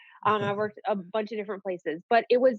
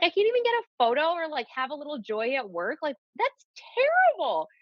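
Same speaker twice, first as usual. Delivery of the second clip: indignant complaint; 7 semitones higher than usual. Retold or imitated. To the host, reliving being pushed aside at work, indignant high outburst. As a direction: let the frustration spill out in a high voice. Retelling outrage at work on Christmas.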